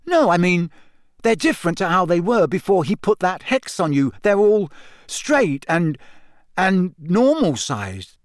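mildly astonished, halting explanation; flustered realisation. A narrator's reading. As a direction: grope for the words, slowing at the end. Ron fumbles explaining what he noticed.

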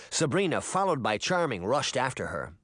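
plain reading voice

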